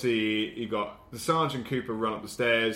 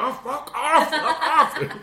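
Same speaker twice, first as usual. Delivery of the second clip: loud, high-pitched shriek of protest; hammed-up frantic shouting; mock panicked yell; 8 dB louder, much slower than usual. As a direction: yell it at a monster, played for laughs. Acting character facing werewolf's head.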